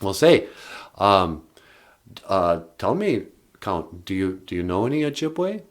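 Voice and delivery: usual speaking voice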